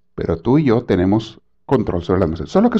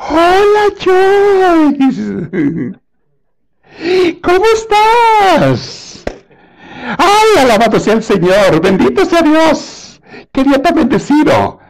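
very high, loud, drawn-out gushing greeting; sudden fake sweetness; 5 dB louder, slower than usual. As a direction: gush piously at an unexpected visitor, hammed up. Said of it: "inmediatamente le cambia la cara. Y va a empezar". (saludo piadoso exagerado, agudo y alargado)

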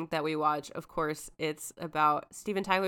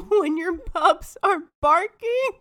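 laughing; 8 semitones higher, 5 dB louder, slower than usual. Real, not acted. Laughing mid-sentence.

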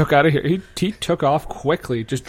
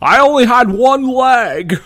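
high, loud, slow comic declaration; hammy character voice; 8 semitones higher, 6 dB louder, slower than usual. Pretend. Star explains his old handicap, hammy and loud. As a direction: proclaim it loud and stretched out. Celebrity bit, boastful character.

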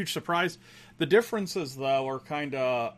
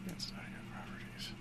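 whisper; 18 dB quieter than usual. Real, not acted. Muttering to himself at settings.

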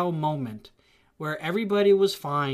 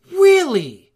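"Really?" shot up high and loud, falling; incredulous really; 9 semitones higher, 13 dB louder than usual. Retold or imitated. Imitating friend's loud incredulous "Really?". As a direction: fire off "Really?" in loud disbelief. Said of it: "You know how you do that?".

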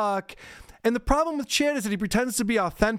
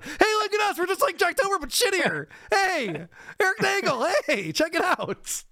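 much higher hammed-up eager pitch; mock overeager host; 10 semitones higher than usual. Host mocking his own episode opener, hammed-up eager. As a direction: ham up a desperate eager intro. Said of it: "That is how I always start every episode".

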